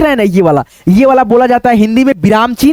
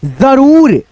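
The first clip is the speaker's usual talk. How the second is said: slow, drawn-out reading; much slower than usual. Shown as a demonstration. Teacher dictating slowly to the class. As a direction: stretch each word as if dictating. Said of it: "उसके बाद से यहाँ पे देखो".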